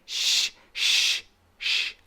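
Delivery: short voiceless "sh" bursts; repeated clean "sh" hisses; SH sound. Shown as a demonstration. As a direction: hush "sh" with no voice. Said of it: "like that sound".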